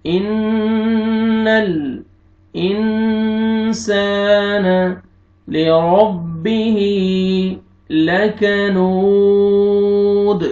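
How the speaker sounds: very slow chanted tajweed, long drawn vowels; slow melodic verse with nasal ghunna; Quran recitation. Shown as a demonstration. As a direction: recite like a qari, stretching every madd. Teacher recites verse, model reading.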